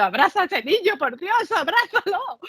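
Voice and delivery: high, urgent plea at the screen; pleading. Retold or imitated. Watching the show at home, urging the mother, high and pleading. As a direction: beg the mother to hug him.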